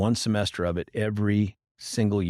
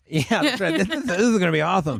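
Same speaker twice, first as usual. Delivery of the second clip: high, fast hammed-up glee over a gif; mock eager presenter; 10 semitones higher, faster than usual. Mocking a rookie lawyer thrilled by a funny gif. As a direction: pitch it up high and rush it like a thrilled newbie.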